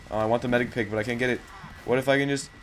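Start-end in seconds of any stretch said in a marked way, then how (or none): none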